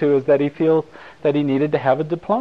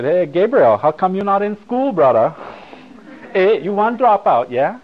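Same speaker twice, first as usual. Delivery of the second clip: higher, louder teasing shopkeeper voice with a Hawaiian pidgin lilt; video store lady; 7 semitones higher, 5 dB louder than usual. Retold or imitated. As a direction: tease him like the lady at the video place, calling him brother. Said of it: "the lady said".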